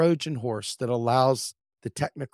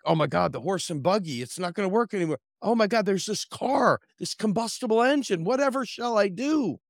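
higher, theatrical fake hand-wringing; mock despair; 7 semitones higher than usual. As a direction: play it like a melodrama victim. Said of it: "It's like".

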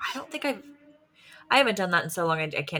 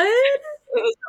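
high, put-on tearful squeak; mock tearful reply; 4 dB louder, faster than usual. Parent retelling the movie moment, high mock-emotional voice. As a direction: imitate crying over a film, pitched high.

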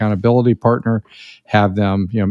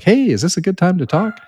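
higher, chirpy mock telemarketer pitch; 9 semitones higher than usual. A mock telemarketer calling a client, higher and chirpy. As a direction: sound like a pushy cold caller asking for time. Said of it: "like, telemarketers do".

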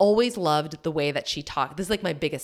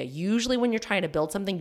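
normal talking voice

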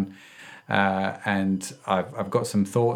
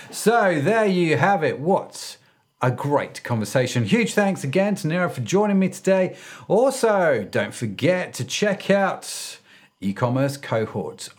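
bright host sign-off read, pitched higher; upbeat podcast outro; 6 semitones higher than usual. Acted. Host closing the episode for listeners, upbeat announcer read. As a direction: wrap up the show like a radio host.

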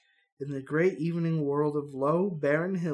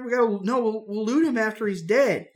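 put-on raised, eager young adventurer; hero voice; 7 semitones higher, 5 dB louder than usual. Hero urging Stacy to stay, eager raised voice. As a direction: talk the hero into it, keyed up.

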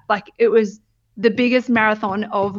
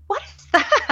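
startled question; 11 semitones higher than usual. Retold or imitated. Startled by photographer's flash.

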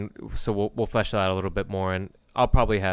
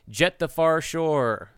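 slow, drawn-out game title; dramatic title announcement; 7 semitones higher, much slower than usual. Acted. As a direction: announce it like a trailer voiceover.

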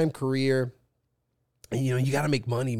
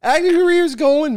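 excited; 13 semitones higher, 9 dB louder than usual. Real, not acted. Sudden high burst.

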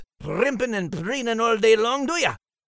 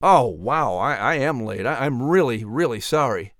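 mock apology; 9 semitones lower than usual. Mocking the late cohost's apology.

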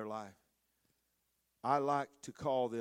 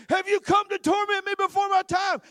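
shrieking high demon, much louder; 14 dB louder than usual. Retold or imitated. Possessed man crying out to Jesus, shrieking demon voice. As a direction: screech it like a tormented demon. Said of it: "They're walking right by going".

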